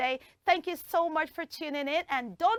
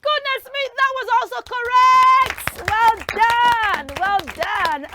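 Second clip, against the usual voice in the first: high, loud cheer of congratulations; excited praise; 8 semitones higher, 9 dB louder than usual. Real, not acted. Host congratulates a child on a correct answer, excited praise. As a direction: burst out with delighted praise.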